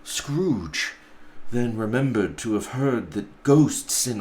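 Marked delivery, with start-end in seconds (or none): none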